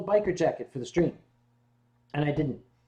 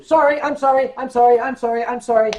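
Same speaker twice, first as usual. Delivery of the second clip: loud, high-pitched gull squawks; squawking seagulls; 5 semitones higher, 10 dB louder than usual. Acted. Translating Canadian seagulls' squawking into apologies, squawked. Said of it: "all that squawking they're doing".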